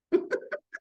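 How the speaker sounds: high airy giggle to start the laughing; an unvoiced giggle that kicks things off; breathy demo laugh. Shown as a demonstration. Showing the audience laughing meditation, airy high giggle. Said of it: "I started laughing".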